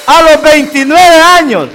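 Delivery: high, loud, stretched-out words; emphatic shout. Real, not acted. Preacher drives the age home to the congregation, emphatic shout. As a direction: proclaim it slowly to the whole room.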